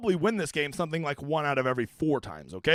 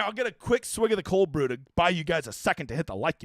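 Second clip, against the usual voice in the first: ordinary talk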